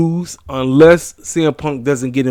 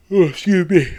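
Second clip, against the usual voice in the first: yawning; 5 semitones higher than usual. Real, not acted. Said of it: "apologize for yawning".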